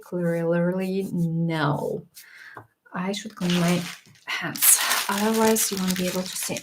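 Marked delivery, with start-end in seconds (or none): 0.0-2.0 slow firm no. Real, not acted. Firm rejection of product.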